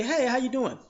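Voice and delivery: casual upbeat hello; a brother greeting a girl on the street, casually friendly; friendly pickup line. Retold or imitated. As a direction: toss off a light greeting. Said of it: "when we say".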